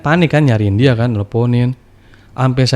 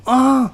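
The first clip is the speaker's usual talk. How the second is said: high startled yelp; mock startled cry; 14 semitones higher than usual. Imitating the wife's startled cry when woken. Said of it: "langsung teriak deh Bang".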